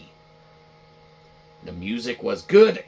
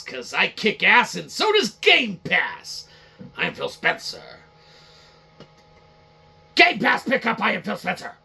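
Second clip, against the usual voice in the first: Phil Spencer impression; 4 semitones higher, 4 dB louder than usual. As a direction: play the self-important exec bragging. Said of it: "Let us do a reenactment of Phil Spencer".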